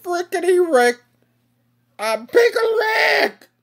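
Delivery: squeaky, very high helium voice. Pretend. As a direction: sound like you just inhaled helium. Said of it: "would sound if he was on helium".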